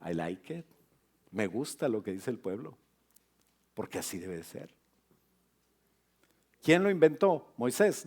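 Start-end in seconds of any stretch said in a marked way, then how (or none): 0.1-4.7 lower, softer, calm divine voice; the Lord answering Moses, low and soft; voice of God. Retold or imitated. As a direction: speak as the Lord, low and unhurried. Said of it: "le dijo el Señor a Moisés".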